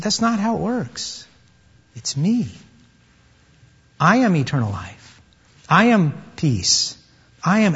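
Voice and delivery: soft, slow words of Jesus; softly voiced, weighty declaration; hushed solemn reply. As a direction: let each statement settle quietly, with pauses. Preacher voices Jesus' answer softly.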